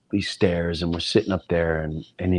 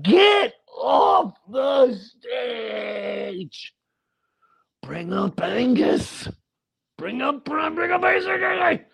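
heckling shout; 12 semitones higher, much slower than usual. Retold or imitated. Teen metal fan heckling the opening act, slow furious yell. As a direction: scream it like an angry teen at a concert. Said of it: "I was just like".

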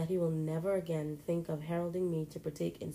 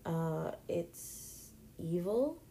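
slow, hesitant and unsure; uncertain; much slower than usual. A narrator's reading. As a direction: drag it out, not sure of the answer. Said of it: "replied uncertainly".

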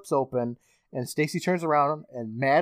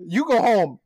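high, loud mock shout; yell; 8 semitones higher, 6 dB louder than usual. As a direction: yell at her to go home. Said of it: "but he yells".

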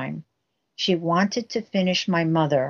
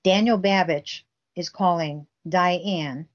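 ordinary delivery

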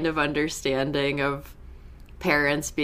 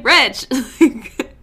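laughing; 8 semitones higher, 6 dB louder, slower than usual. Retold or imitated. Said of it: "I just kept writing".

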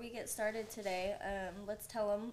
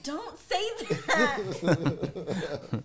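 laughing; 8 dB louder, much slower than usual. Retold or imitated. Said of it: "I was like".

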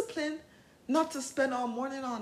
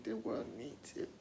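low tender reassurance; 8 semitones lower, 8 dB quieter than usual. Shown as a demonstration. Reassuring her inner teen.

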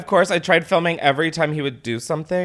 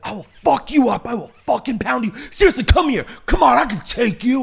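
higher put-on tough-guy taunt; mock threatening bro; 9 semitones higher, 4 dB louder than usual. The angry driver out of his car, hammed-up threat. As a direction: do a cartoonish angry man daring her.

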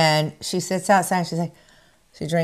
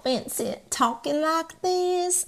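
fake Southern accent; 5 semitones higher, 6 dB quieter than usual. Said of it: "fake Southern accent".

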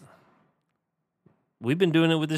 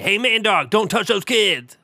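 higher, louder called warning; calling out; 6 semitones higher, 5 dB louder than usual. Pretend. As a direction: call it out from a passing bike. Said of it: "and say".

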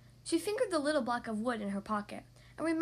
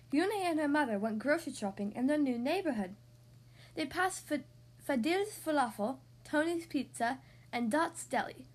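plain reading voice

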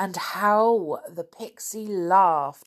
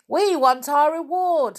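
high, loud crowd demand; sudden shout; 9 semitones higher, 7 dB louder than usual. Acted. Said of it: "suddenly shouted someone".

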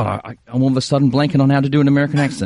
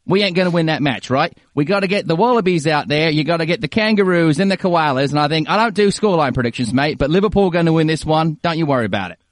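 easygoing, hammed-up Aussie accent; Australian accent. Both hosts doing Australian for the podcast, mock-Aussie match prediction. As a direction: put on a broad Australian accent. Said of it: "Let's just both do Australian".

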